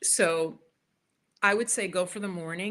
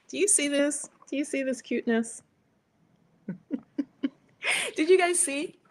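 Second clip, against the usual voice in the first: cooing over cat; 8 semitones higher, 4 dB louder than usual. Real, not acted.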